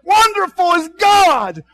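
high, loud, slow cry of praise; joyful shout. Retold or imitated. Preacher showing how psalms end, shouted joyfully. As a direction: burst out in praise. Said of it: "and end up".

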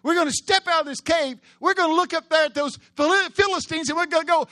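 high, loud, ringing preacher voice; full-force preaching. Acted. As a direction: preach it out to the whole room.

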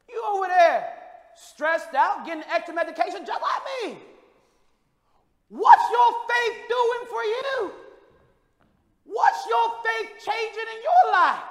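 high, loud challenging cry; shouted pulpit challenge; preaching challenge. Acted. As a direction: call out the listener directly. Calling out congregation.

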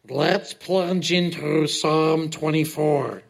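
slow, gurgly underwater voice. Pretend. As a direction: talk like you're under water. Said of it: "That was supposed to be my underwater voice".

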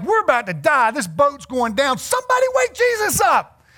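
urgent high cry building to a shout; hammed-up alarm, rising into a yell; mock panic. Preacher voices disciples on a sinking boat, mock panic. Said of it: "disciples are freaking out".

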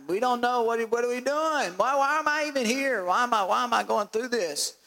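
acted-out exasperated questioning; acted complaint. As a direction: complain as if you regret coming along. Acting out doubter complaining to Jesus.

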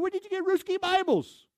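astonished manager. Retold or imitated. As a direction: disbelief at a treasure. Manager stunned by the Bibles.

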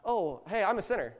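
high-pitched casual greeting, played for absurdity; hammed-up party hello; mock cheery introduction.

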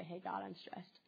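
soft whisper to God; whispered confession. Pretend. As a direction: confide it to God under your breath.

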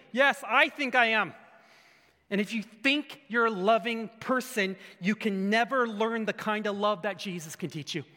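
normal talking voice

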